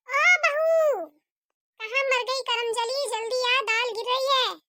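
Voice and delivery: shouted summons of an irritated mother-in-law; calling out crossly. Acted. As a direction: yell for someone in another room, easing slightly after. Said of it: "इतनी देर से चिल्लाए जा रही हूँ".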